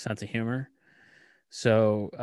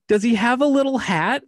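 much higher and louder, delighted; gleeful teasing; 13 semitones higher, 10 dB louder than usual. Retold or imitated. Dad teasing about the conductor's hat, giddy high voice. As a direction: tease with giddy glee. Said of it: "and I go".

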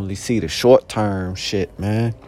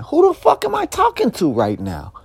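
"Who the fuck am I talking to right now?" yelled much higher, "fuck" hit hard; outraged high yell. Retold or imitated. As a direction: shout it up high in disbelief. Repeats outraged question twice.

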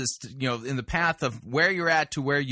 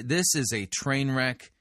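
ordinary talk, nothing marked

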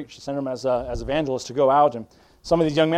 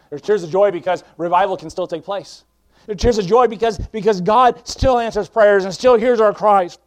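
climbing into loud, fervent preaching; building preaching; 7 semitones higher, 8 dB louder than usual. Acted. Preacher proclaiming tears of joy to the church, building louder. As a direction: swell from calm to full force.

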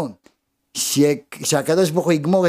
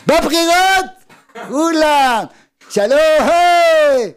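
loud, high hollered greetings; mock hearty shout; 11 semitones higher, 5 dB louder, slower than usual. Preacher mimics politicians greeting voters at elections, mock hearty shouting. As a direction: holler it out like a campaigner working a crowd. Acts out election-time glad-handing.

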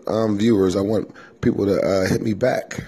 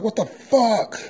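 shocked cuss; 14 semitones higher, slower than usual. Retold or imitated. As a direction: shout it out as the plate hits the floor. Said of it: "I was like".

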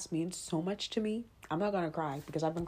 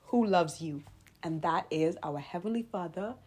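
ordinary talk, nothing marked

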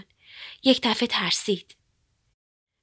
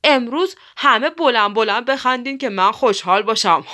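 bossy woodpecker; 6 dB louder than usual. A narrator's reading.

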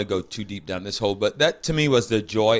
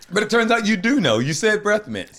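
playful teasing; 10 semitones higher than usual. Real, not acted. A friend pounces on a slip, teasing and higher.

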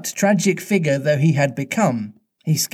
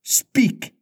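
demanding; 10 semitones higher than usual. A narrator's reading. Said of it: "Hook demanded".

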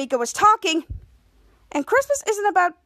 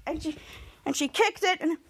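plain reading voice

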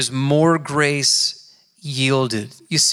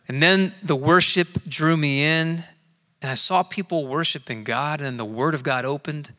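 ordinary talk

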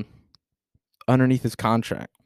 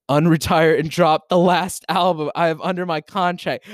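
higher, louder, gloating excitement; gleeful outburst; 6 semitones higher, 4 dB louder than usual. Real, not acted. Streamer reading the post aloud to chat, gleefully crowing. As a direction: crow over being right.

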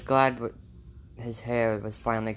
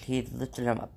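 usual speaking voice

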